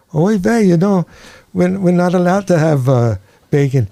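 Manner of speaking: high Yiddish rabbi voice; Yiddish accent. Acted. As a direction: play the old rabbi, Yiddish lilt.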